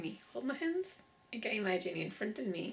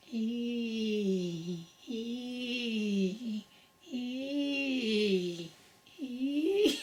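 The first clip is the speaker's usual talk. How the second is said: rising ee vocal; 2 semitones higher, 3 dB louder, much slower than usual. Shown as a demonstration. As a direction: sing out ee, building up into a laugh. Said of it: "Let's try a knee".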